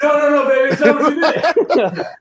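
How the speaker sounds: high, loud mock-desperate excuse; panicked pleading. Caught out as Calista walks in, mock-panicked pleading. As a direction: plead frantically, hammed up and high. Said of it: "Fucking Calista walks in at this exact moment".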